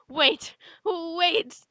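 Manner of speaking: loud, rising alarm; hammed-up urgent shout; panicked plea. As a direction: cry out to stop the countdown. Panicked interruption during countdown.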